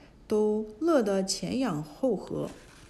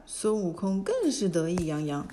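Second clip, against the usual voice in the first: usual speaking voice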